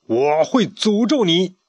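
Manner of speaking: slow, menacing threat; stern curse. Acted. Pharaoh cursing the chick, stern slow threat. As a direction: lay the curse slowly and sternly. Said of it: "语气严厉地说".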